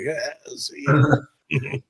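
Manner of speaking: very slow, drawn-out careless farewell; smug goodbye. Retold or imitated. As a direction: let it roll out lazily, totally unbothered. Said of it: "And I'm just like".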